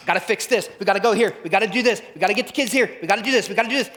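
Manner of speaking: high, loud, rushed inner voice; frantic busy self. Retold or imitated. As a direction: play the anxious fixer, babbling fast. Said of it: "Don't always go".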